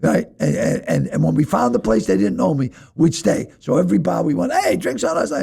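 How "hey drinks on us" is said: very high party shout; cheery call. Retold or imitated. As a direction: announce drinks, buoyant. Calling out in bars.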